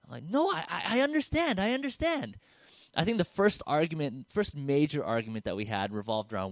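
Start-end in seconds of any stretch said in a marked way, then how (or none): 0.4-2.4 hammed-up high-pitched flustered protest; high panicked protest. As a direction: squeak out the reassurance in a rush. Retells panicked reply to girlfriend.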